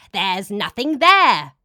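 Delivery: loud, slow furious demon roar; demon screaming. A narrator's reading. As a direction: scream it at the underlings. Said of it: "The demon screamed at its subordinates".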